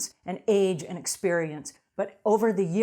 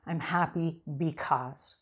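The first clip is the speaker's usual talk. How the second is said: softer, slow "I'm happy because"; slow emphasis; 3 semitones lower, 4 dB quieter, slower than usual. Shown as a demonstration. Coach giving the phrase to repeat, slow and soft. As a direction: hand them the phrase slowly and gently. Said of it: "I want you to say".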